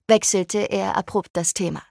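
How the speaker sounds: usual speaking voice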